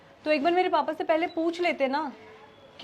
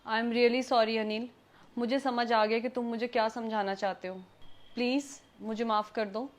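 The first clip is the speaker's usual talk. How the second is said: soft, low, contrite voice; sincere apology; 5 semitones lower, 7 dB quieter than usual. Acted. Wife asks husband to forgive her, apologetic. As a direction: apologise gently, voice dropped.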